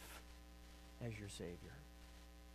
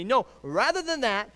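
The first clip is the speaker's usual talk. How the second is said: building to high, loud pulpit fervor; fervent preaching; 10 semitones higher, 13 dB louder than usual. Real, not acted. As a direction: preach it with mounting urgency and pitch. Preaches at full force.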